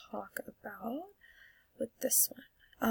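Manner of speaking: trailing off into a whisper; unvoiced aside. Real, not acted. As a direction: let the words fade to breath.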